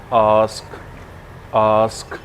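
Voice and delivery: आस्क repeated with a held "aa"; long aa sound. Shown as a demonstration. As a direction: stretch the vowel, "aask", each time. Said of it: "थोड़ी सी long sound के साथ बोलना है".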